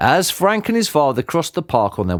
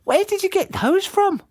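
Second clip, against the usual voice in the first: put-on high boyish voice, curious; boy's voice, puzzled; young boy. A narrator's reading. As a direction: lift into a young lad asking. Boy's voice in story.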